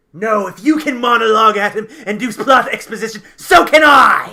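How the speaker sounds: high, very loud defiant shout; defiant outburst. Acted. Captive shouting back at a monologuing captor, defiant outburst. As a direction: shout over the villain in defiance.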